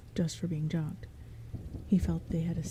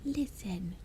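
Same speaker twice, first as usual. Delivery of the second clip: admonishing whisper; 5 dB quieter than usual. Acted. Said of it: "admonished the rest".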